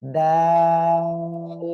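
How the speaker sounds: loud দাল, "aa" held long on a level note, closing on l; long drawn-out. Shown as a demonstration. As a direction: intone দাল very slowly. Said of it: "তিন আলিফ টেনে পড়তে হবে".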